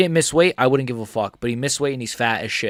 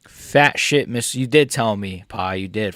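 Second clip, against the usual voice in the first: ordinary talk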